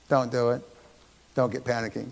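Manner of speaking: ordinary delivery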